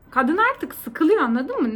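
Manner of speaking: ordinary talk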